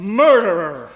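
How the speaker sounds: loud sudden cry of murderer; accusing shout. Acted. Jules bursting in on Hilda, shouting the accusation. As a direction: blurt the accusation at full volume.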